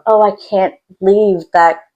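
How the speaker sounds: ordinary talk, nothing marked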